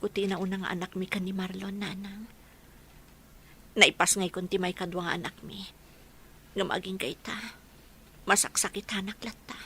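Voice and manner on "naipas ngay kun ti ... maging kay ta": ordinary talk, nothing marked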